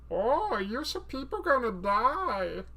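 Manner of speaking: high, slow goofy villain; Jar Jar Binks as Bane. Acted. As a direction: drawl the threat in a silly alien voice. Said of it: "Jar Jar Binks, but it's actually Bane".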